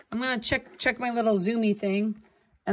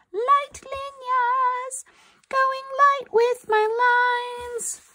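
high, slow sing-song on light; 13 semitones higher, much slower than usual. Shown as a demonstration. Teacher crooning to her class, high and slow.

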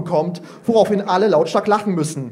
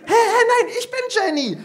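put-on high, giggling child's voice; a kid correcting the teacher, laughing; giggly little girl. Jenny insists on her name to the teacher, high giggles.

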